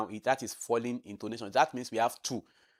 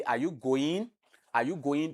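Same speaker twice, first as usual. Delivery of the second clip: "Are you going" with the voice raised at the end; rising intonation; 3 semitones higher than usual. Shown as a demonstration. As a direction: lift the pitch on "going" as a yes-no question. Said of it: "because I raise my voice when I end".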